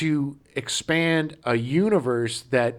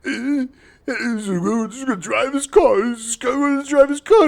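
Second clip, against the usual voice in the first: dopey character voice; 11 semitones higher, 4 dB louder than usual. Retold or imitated. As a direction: play the character as a goofy dimwit. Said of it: "when he's like".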